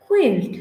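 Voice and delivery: "কুইল্ট" said khuilt, puff after K; breathy kh opening "কুইল্ট"; aspirated K. Shown as a demonstration. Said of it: "উচ্চারণটা হবে". ("কুইল্ট"-এর ক-কে খ করে মহাপ্রাণ)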